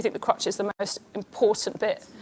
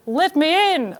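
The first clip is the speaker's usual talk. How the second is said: higher, put-on Australian husband; mock Australian call, pitched up; Australian accent; 5 semitones higher, slower than usual. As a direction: imitate an Australian husband calling out. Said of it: "he's Australian. He's like".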